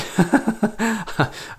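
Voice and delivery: bright burst of high laughter; surprised laugh. Real, not acted. Player sees the test result come up, surprised high laugh.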